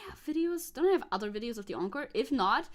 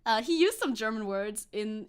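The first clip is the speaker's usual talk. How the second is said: ordinary talk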